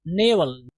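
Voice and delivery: "नेवल" with an 'ay' vowel in the first syllable; सही उच्चारण. Shown as a demonstration. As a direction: say "नेवल" as NAY-vul. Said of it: "ये नेवल पढ़ा जाएगा". ("नेवल" का सही उच्चारण, पहले अक्षर में 'ए')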